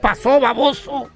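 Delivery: lightly played bossy wife; scolding wife. Wife grilling her late husband, mock-angry. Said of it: "Y la sargento".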